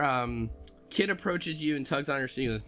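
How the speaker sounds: ordinary delivery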